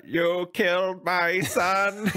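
dramatic slow voice. Pretend. Dramatic mock line.

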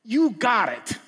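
high, bright encouraging cheer; Jesus eagerly urging Peter out; excited Jesus. Retold or imitated. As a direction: cheer him on out of the boat. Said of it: "and Jesus says".

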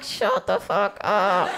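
low slow loud snap; snapping mom. Retold or imitated. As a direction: bite it out at the kid.

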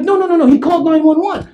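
high, insistent burst; urgent correction. Retold or imitated. Police correcting the unworried homeowner, urgent. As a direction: cut in urgently at a high pitch.

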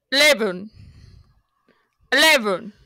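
badly faked English accent; hammed-up English accent; attempted English accent. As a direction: put on a mock English accent. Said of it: "Try an English accent".

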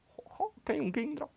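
high giddy jo jo; high chuckle. Real, not acted. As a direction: chuckle in delight. Spots rare Pokémon. (risita aguda de sorpresa)

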